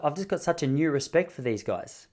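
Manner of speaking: ordinary talk, nothing marked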